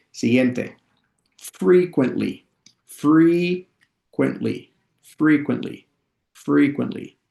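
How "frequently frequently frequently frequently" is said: higher, stretched-out teaching voice; slow model pronunciation. Shown as a demonstration. Teacher models "frequently" for students to practise, slowly. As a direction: draw out the first syllable of "frequently". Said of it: "lo voy a decir lento".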